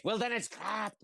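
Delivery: a light Scottish shopkeeper voice waving off the customer; grumpy Scottish shopkeeper. Retold or imitated. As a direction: dismiss it like a cranky Scottish shop owner.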